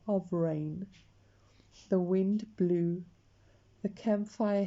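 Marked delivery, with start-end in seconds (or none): none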